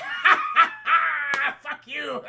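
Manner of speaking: cackling mockery, laughing loud at a dead rival; gloating laugh. [name] laughing at Skinny Andy's death, loud mocking cackle. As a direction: laugh in his face, then spit out the curse.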